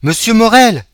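high, loud call from the stairs. Acted. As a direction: shout up the stairwell. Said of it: "s'écria une voix dans l'escalier". (appel crié depuis l'escalier)

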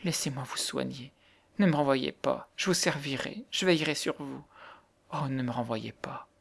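hushed, breath-soft begging; supplicating voice. A narrator's reading. Poor girl offering to nurse the widow, hushed supplication. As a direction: plead softly not to be sent away. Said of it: "répétait d'une voix suppliante".

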